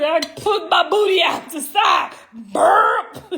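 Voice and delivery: laughing shout. Real, not acted. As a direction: burst out laughing mid-story. Laughing at her own story.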